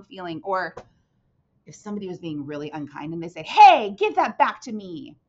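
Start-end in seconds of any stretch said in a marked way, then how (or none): 3.4-5.2 loud, slowed angry demand; teacher acting out an angry exclamation. Shown as a demonstration. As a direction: snap it back at an unkind kid. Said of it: "they're really angry".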